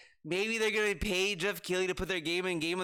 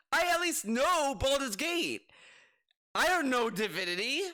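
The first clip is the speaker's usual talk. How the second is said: ordinary talk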